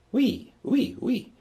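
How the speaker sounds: "we" high and bright, like the ue in queen. Shown as a demonstration. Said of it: "like queen".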